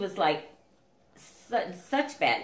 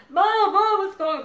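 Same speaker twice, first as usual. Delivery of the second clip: put-on high loud frightened child's yell, laughing through it; mock scream; 13 semitones higher, 10 dB louder than usual. Scared younger brother calling for mom in the night, mock scream. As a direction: shriek like a scared little brother. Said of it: "Donnie would be screaming".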